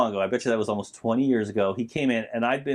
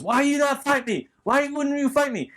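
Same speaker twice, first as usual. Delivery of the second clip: much higher, gruff Mister T growl; Clubber Lang impression; 14 semitones higher than usual. Retelling Rocky three, Clubber taunting Rocky at statue. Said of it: "He goes".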